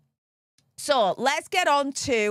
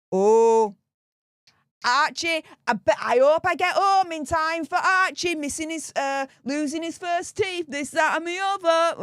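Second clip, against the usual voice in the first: higher, hammed-up imitation of Meghan gushing; sarcastic mimicry of a gushing celebrity mum, pitched up; mock Meghan; 5 semitones higher than usual. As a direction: parody her gushing, pitched up. Mocking Meghan's words about Archie.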